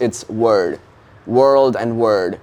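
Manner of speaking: slow American accent with rounded R in "word". Shown as a demonstration. Actor shows interviewer American entry words, American accent. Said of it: "in the American".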